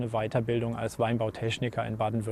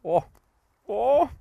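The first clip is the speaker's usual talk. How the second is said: surprised oh; 9 semitones higher, 5 dB louder than usual. Real, not acted. Tasting an unripe grape.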